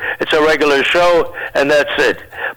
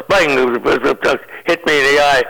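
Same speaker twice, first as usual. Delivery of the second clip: usual speaking voice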